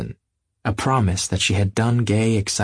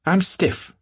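complaining; 11 semitones higher than usual. Acted. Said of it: "she complained".

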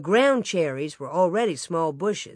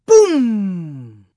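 booming explosion; 9 dB louder than usual. A narrator's reading. Cannon-like blast jolting sleepers awake, booming. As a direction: sound the blast, long and falling.

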